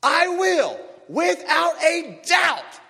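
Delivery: high, loud, very slow shouted promise; emphatic shout. Shown as a demonstration. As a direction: shout each word as certain truth. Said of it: "repeated with more force".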